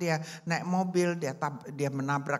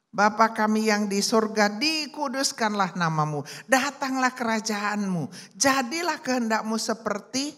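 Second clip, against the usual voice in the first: prayer recitation; 5 semitones higher, 5 dB louder than usual. A narrator's reading.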